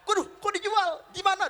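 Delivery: very high, startled questioning; acted dismay. Abu Bakar learns his companion was sold, startled dismay. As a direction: react aghast, pitch shooting up.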